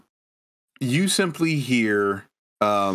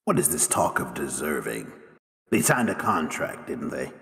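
low, quiet in-character challenge; stern questioner; 11 semitones lower, 4 dB quieter than usual. Acted. Game master as Maggie challenging the players' pleas, stern questioner. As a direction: question them low and coldly.

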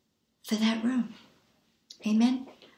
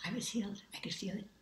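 voiceless, hushed wonder; whispered wonder at healing; awed whisper; 14 dB quieter, much faster than usual. Retold or imitated. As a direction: whisper it like a miracle. Quiet awe of healing.

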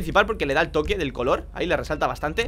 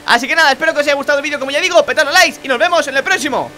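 loud, fast, high sign-off; energetic YouTube outro; 7 semitones higher, 6 dB louder than usual. Acted. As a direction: hype up the viewers to say goodbye. Upbeat channel outro.

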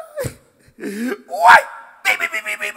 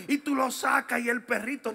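usual speaking voice

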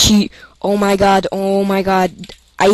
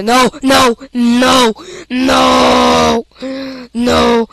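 panicked shouting; 4 semitones higher, much slower than usual. Real, not acted. His stuff is lost.

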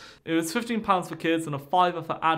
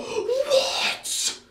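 very high stretched "What?"; hammed-up stunned mum; shocked shriek. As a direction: shriek in disbelief at the refusal. Mother hears no booking.

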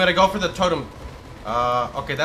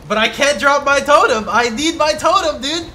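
loud, higher desperate yell; frustrated shout; 8 semitones higher, 9 dB louder than usual. Real, not acted. Frustrated panic in fight.

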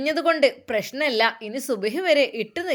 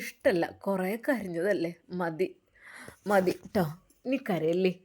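tender consoling mother; 3 semitones lower, 4 dB quieter than usual. Acted. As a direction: lower and softer, a mother's gentle comfort. Mother comforting crying daughter. (താഴ്ന്ന, മൃദുവായ, ആശ്വസിപ്പിക്കുന്ന ഉമ്മയുടെ ശബ്ദം)

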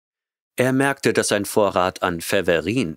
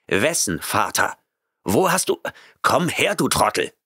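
roared command; 4 semitones higher than usual. A narrator's reading. Grant roaring at the cat to come. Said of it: "brüllte Grant".

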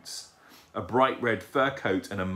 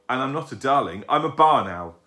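put-on louder, higher childish correction; indignant owlet; 4 semitones higher, 7 dB louder than usual. A narrator's reading. Owlet correcting the young lady, indignant. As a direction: insist on it as an owlet.

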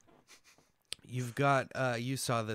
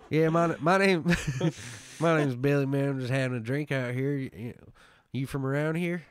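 mock Southern twang; 3 semitones higher, 6 dB louder than usual. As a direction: play the bar guy badly, cracking up. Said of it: "a person with this accent".

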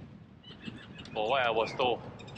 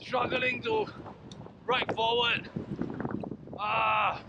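raised, strained voice into the wind; shouting over wind; 8 semitones higher, slower than usual. Real, not acted. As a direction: push the words out against gusting wind. Riding against strong headwind.

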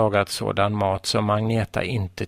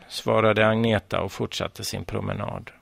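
ordinary delivery

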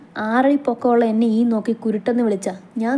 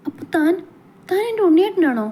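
sad hopeful question; 8 semitones higher than usual. Acted. Shivika asks Archith if he is her Unni, sad and hopeful. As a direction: ask with sorrow and hope rising together. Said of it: "സങ്കടമുണ്ടായിരുന്നു, പ്രതീക്ഷയുണ്ടായിരുന്നു, സന്തോഷമുണ്ടായിരുന്നു".

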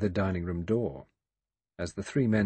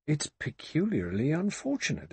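butler fretting, earnest and uneasy; worried butler; 7 semitones higher than usual. A narrator's reading. Butler Rogers fretting to the guests, earnestly uneasy. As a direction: say it as a genuine worry. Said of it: "Rogers murmured".

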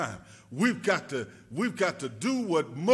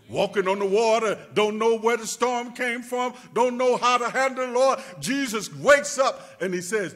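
raised preaching voice; preaching loud; 6 semitones higher, 6 dB louder than usual. Acted. Preacher retelling the storm to the church, raised. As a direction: push it out over the pews.

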